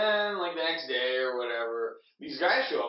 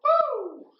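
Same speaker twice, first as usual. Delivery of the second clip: very high, loud woo; whoop; 7 dB louder than usual. Real, not acted. Storyteller after a refill, high loud whoop.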